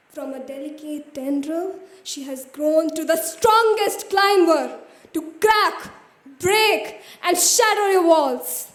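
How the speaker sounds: loud, forceful poetic climax; triumphant declamation. Acted. Poet declaring the bird will shatter the walls, loud triumphant recital. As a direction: build from the first phrase into ringing, emphatic proclamation.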